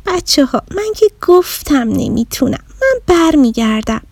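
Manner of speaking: a little bear close to tears, quitting; young bear sadly saying she will go home; sadly giving up. Acted. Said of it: "با ناراحتی رو کرد به دوستاش".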